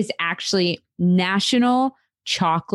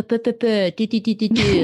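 wordless mock-French babble, a touch higher; French impression; 5 semitones higher than usual. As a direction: mimic the sound of French talk, playful. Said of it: "the best French impression ever".

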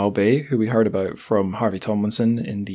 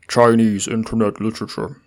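odd, distorted put-on bold-type voice; bold text voice. Shown as a demonstration. Host apologising after bolded blurb words, bold text voice. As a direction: ham up the bold print until it goes silly. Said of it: "bold text voice is getting worse".

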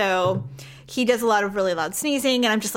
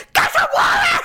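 much higher, louder yell with a numb mouth; 5 dB louder than usual. Retold or imitated. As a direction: yell it with a dental-numb tongue. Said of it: "really loud in my numb voice".

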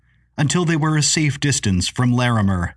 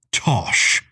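ordinary delivery

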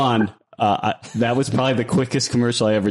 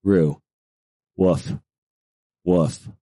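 low, short spoken woofs; deadpan dog impression; dog bark; 4 semitones lower, much slower than usual. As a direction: imitate a dog, low and spaced out. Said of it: "And one more".

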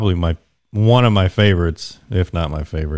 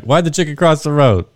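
mock hacky joke; 7 semitones higher, 4 dB louder than usual. Mocking a hack stand-up joke.

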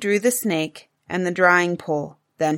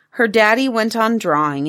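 plain reading voice

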